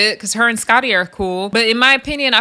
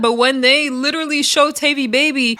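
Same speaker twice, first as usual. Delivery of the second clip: normal talking voice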